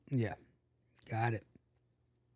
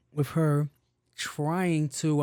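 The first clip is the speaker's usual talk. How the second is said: ordinary talk, nothing marked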